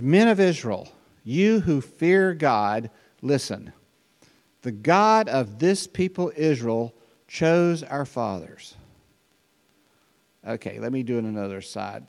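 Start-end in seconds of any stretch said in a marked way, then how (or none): 0.0-8.7 proclaiming. Acted. Reading Paul's speech aloud.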